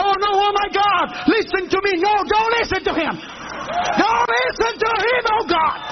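high-pitched frantic prayer; acted desperate pleading. Praying aloud on the falling plane, hammed-up desperation.

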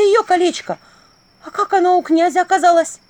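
high, loud astonishment; shocked bewilderment. A narrator's reading. Vasilisa seeing the ring on the prince's finger, shocked outburst. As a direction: blurt it out in alarm.

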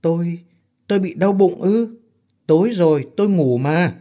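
a man puzzled by his own hospital bed; dazed voice. Acted. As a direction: sound confused, just woken up. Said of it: "Ân nói giọng ngớ ngẩn".

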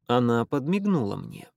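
ordinary delivery, nothing marked

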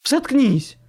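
high, loud female snap; sharp shout to silence him; barked order. A narrator's reading. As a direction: bark it furiously as a woman. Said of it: "рявкнул женский голос".

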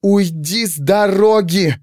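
slow, high, emphatic order; deliberately drawn-out words. A narrator's reading. Pandora ordering the servant aside, deliberately drawn out. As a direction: stretch each word out as a command. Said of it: "отчеканила девушка, нарочито растягивая слова".

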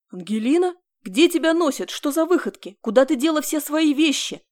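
loud, sharp demand where she's been; angry shout of worried husband; angry scolding. Acted.

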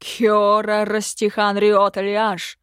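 slow, loud spell casting; drawn-out commanding shout; cried-out incantation. A narrator's reading. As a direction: call out each foreign word forcefully. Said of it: "выкрикнул он".

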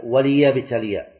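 وليبتلي with the lam clearly voweled, wa-li-yabtaliya, slow; correct reading. Shown as a demonstration. Teacher modelling the kasra on the lam of وليبتلي, slowly. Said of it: "لا، هي".

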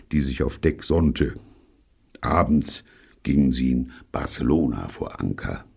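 ordinary delivery, nothing marked

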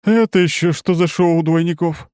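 high, loud astonished outburst; genuinely thrown by the sight. A narrator's reading. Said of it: "нехило изумляется бортмех".

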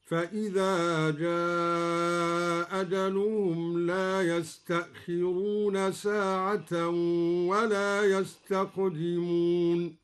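"جاء أجلهم" with one hamza after a long drawn aa; slow recitation, extended madd in "جاء أجلهم"; dropped hamza, lengthened vowel. Shown as a demonstration. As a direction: stretch the aa of "جاء أجلهم", then a single glottal stop. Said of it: "بإسقاط الهمزة وله المد والقصر".

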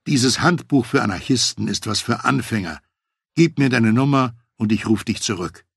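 ordinary delivery, nothing marked

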